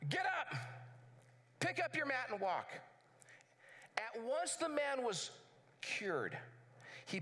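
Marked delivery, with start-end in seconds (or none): 0.1-2.7 commanding call. Acted. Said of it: "he yelled it".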